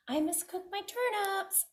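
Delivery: high, soft excuse; prim pig. Acted. Pig turning down the wolf, high and soft. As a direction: politely decline.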